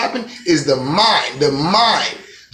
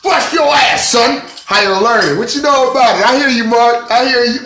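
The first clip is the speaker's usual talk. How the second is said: loud, high and heated street confrontation; acted shouting match; 7 semitones higher, 4 dB louder than usual. Acting out a hallway showdown, shouting loud and high. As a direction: yell it like you're squaring up on campus.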